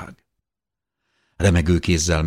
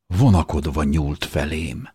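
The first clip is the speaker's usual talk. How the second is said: ordinary delivery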